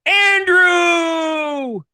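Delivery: high, loud game-show intro; announcer voice. Acted. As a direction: build to a big shout on the name. Announcing guest like a show host.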